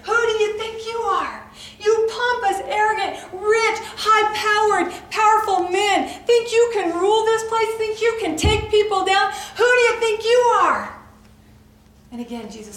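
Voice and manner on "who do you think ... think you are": much louder, higher screaming at the men; confronting the Pharisees in a rage; furious shout. Retold or imitated. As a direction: scream it in their faces. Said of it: "I see myself screaming in their face".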